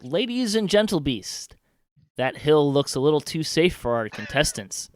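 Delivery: high, loud showman announcing; arena announcer. Acted. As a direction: boom it out to the whole crowd. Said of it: "the announcer's voice calls out".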